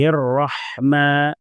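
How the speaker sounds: الرحمن slow, with the ha clearly breathed; ha kept audible. Shown as a demonstration. Teacher showing students the ha sound, slowly. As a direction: let the ha in الرحمن be heard. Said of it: "ہا کی آواز کو باقی رکھنا ہے".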